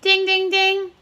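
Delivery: high, even sing-out dings; imitated bell. Teacher signalling the right answer to children, imitated bell. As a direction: chime like a quiz-show bell.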